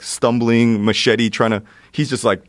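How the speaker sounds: ordinary delivery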